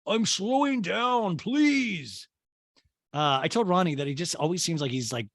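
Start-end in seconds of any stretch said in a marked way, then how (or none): 0.1-2.3 high, stretched mock pleading; whiny six-year-old. Grown man begging Madison to pedal, high childish whine. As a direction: beg like an impatient little kid. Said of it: "just like a six-year-old to his parent".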